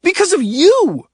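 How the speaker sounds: high, loud blaming yell; yelled accusation. A narrator's reading. Oscar yelling at Adrian, blaming him for Dana. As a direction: throw the blame right at him. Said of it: "Oscar yelled".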